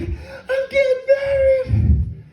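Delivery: high, slow, tearful squeal; squealing bride. Retold or imitated. Bride announcing her engagement to friends, high tearful squeal. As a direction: stretch it out in happy tears. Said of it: "She's like".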